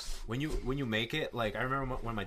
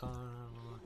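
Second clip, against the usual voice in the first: soft, mumbled imitation of cops talking; mimicked distant chatter; 13 dB quieter than usual. Cops talking to dad out of earshot, soft mimicry. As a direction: imitate overheard talk under your breath.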